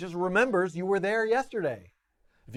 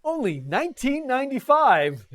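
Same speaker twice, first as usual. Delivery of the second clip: higher, louder infomercial price reveal; mock price pitch; 8 semitones higher, 4 dB louder than usual. Joking that viewers must pay, hammed-up ad announcer. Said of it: "yes, you are getting sold something".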